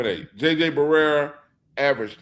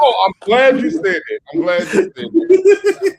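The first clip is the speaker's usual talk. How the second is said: high, loud triumphant crow; laughing, gloating cry of triumph; gleeful gloating; 12 semitones higher, 7 dB louder than usual. Real, not acted. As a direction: pounce on his mistake with glee. Pouncing on opponent's slip, gloating.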